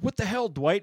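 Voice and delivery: sharp high yell at a friend; retelling a boss yelling at his coworker, high mock yell. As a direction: snap the question out, high and loud. Michael yelling at Dwight, retold.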